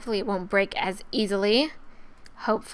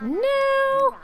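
"now" shot up high; high, insistent "now"; emphatic order; 4 dB louder than usual. Real, not acted. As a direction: stretch "now" upward as an order. Orders character to bed.

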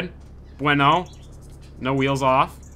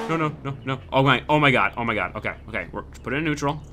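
ordinary talk

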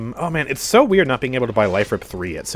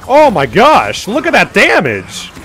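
excited; 8 semitones higher, 8 dB louder, slower than usual. Real, not acted. Excited at big damage.

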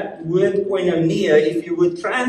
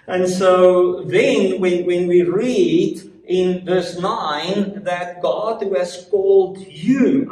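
ordinary talk, nothing marked

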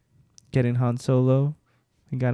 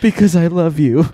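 loud, higher mock declaration of love; hammed-up romantic; 6 semitones higher, 9 dB louder than usual. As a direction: overplay the love confession. Acting a love line in story.

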